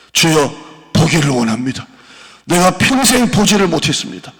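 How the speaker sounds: loud, high desperate plea; a blind beggar crying out to Jesus. Retold or imitated. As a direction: shout the prayer with all your strength. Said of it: "더욱 크게 소리 지".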